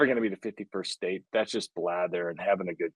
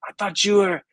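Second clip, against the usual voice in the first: lightly voiced French cabbie, higher, with a sigh of relief; mock relief from the Nice taxi driver, pitched up; relieved French cabbie; 8 semitones higher, 4 dB louder, slower than usual. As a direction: play the cabbie relieved he's not talking to a Quebecer. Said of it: "He's like".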